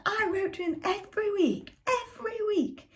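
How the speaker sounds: insist, voice straining upward; desperate mother. A narrator's reading. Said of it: "And Mother shouted".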